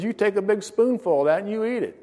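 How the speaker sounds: high-pitched, firm insistence; insistent order. Acted. God orders him to eat.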